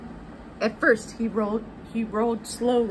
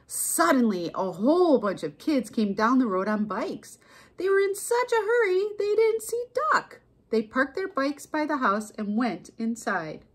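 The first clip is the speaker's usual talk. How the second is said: animated read-aloud, rising high for the hurry; storybook narration; 4 semitones higher than usual. A narrator's reading. Narrator telling of kids arriving on bikes, storybook narration. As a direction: narrate with building urgency, then settle. Reads a picture book.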